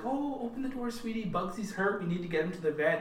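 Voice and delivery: high, soft sweet-mother voice; really gentle. Retold or imitated. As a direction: coax gently, high and sing-song, imitating her. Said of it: "she's flopping between really gentle".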